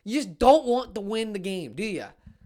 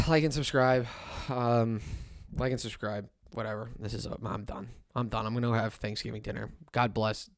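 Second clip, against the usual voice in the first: low, very soft, drained sign-off; defeated mumble; 11 semitones lower, 10 dB quieter than usual. Real, not acted. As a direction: trail off like you've given up. Dejected sign-off.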